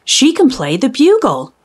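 ordinary delivery, nothing marked

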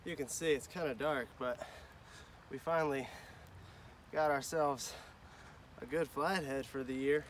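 distant, quiet recap; far from mic. Real, not acted. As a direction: speak from across the bank.

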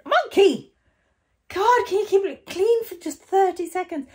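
high, loud squawking puppet; shrieking puppet voice. Acted. Puppet shrieks at its owner to keep it clean, high and loud. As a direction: squeal it out like a cheeky puppet.

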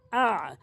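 আ made from the middle of the throat; throat ayn. Shown as a demonstration. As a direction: squeeze আ mid-throat as Arabic ayn. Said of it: "হলকের মাঝখান থেকে".

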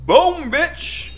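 very high, loud victory squeal; triumphant shout. Real, not acted. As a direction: crow over the kill. Celebrating killing enemies.

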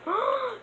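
sharp, very high gasp of surprise; high startled gasp; excited gasp. Real, not acted. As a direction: gasp at what just appeared. Spotting the Speed Cola perk.

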